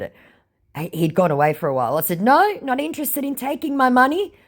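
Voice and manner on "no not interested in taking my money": high, bright mock scammer voice; mock scammer denial. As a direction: protest innocence like a caught scammer.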